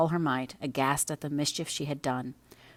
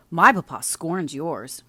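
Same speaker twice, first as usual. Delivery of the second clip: angry cry; 2 semitones higher, 8 dB louder, slower than usual. Acted. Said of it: "cried Linton".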